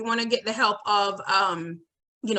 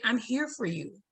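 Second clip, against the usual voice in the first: much softer, gentle and sincere; soft reassurance; 2 semitones lower, 9 dB quieter than usual. Shown as a demonstration. Teacher comforting an upset student, softly and gently. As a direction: reassure a child who is hurting. Teacher demos kind alternative phrase.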